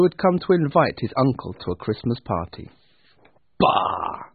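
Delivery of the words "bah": low, scornful grunt; grumpy Scrooge. Acted. Scrooge dismissing his nephew's greeting, grumpy. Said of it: "said Scrooge".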